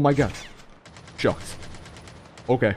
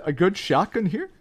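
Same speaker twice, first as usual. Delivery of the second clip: ordinary talk, nothing marked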